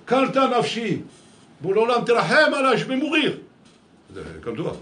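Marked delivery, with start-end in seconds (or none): 0.1-1.0 fervent cry. Retold or imitated. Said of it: "il disait". 1.6-3.4 higher, louder cry of longing; fervent cry. Retold or imitated. David crying out his love for God, high and loud. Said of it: "il disait".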